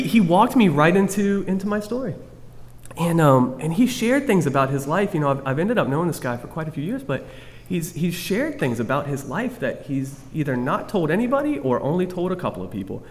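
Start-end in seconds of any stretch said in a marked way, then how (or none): none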